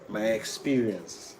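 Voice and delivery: normal talking voice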